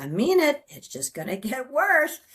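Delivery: imitating an irritated woman's warning; mock angry warning.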